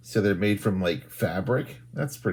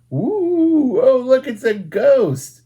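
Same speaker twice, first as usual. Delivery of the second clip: high and louder, slow delighted ooh; excited reveal; 13 semitones higher, 8 dB louder, much slower than usual. Pretend. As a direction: gasp of delight at the ghost. Ghost revealed to children.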